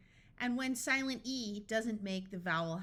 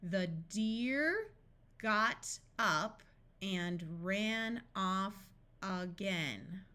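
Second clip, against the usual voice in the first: slow, with gaps between words; deliberate, spaced-out practice reading; much slower than usual. Shown as a demonstration. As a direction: separate each word for a beginning reader. Practice sentence read word by word.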